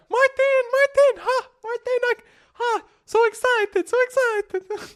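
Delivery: very high, squeaky, bursting Italian voice; hammed-up Italian accent, giddy with excitement; excited Italian accent. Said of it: "that was an Italian accent".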